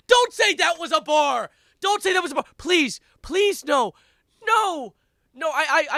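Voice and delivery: high, loud desperate yelling; pleading shout. Real, not acted. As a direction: beg them loudly to stop. Begging after a bad rap line.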